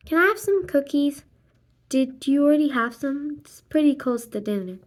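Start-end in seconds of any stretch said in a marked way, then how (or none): none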